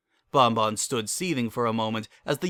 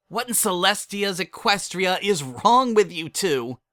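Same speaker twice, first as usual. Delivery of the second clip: high, slow, indignant shout; a daughter berates her parents in public; exasperated outrage; 8 semitones higher, 3 dB louder than usual. Acted. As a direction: scold your parents. Lyra raging at parents.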